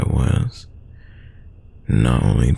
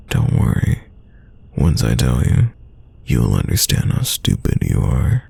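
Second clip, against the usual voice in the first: normal talking voice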